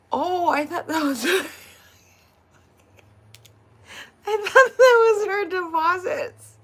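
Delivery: high, loud, laughter-choked words; cracking up while talking. Real, not acted. Retelling the mistake over her deposits, cracking up. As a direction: squeeze the words out through laughter.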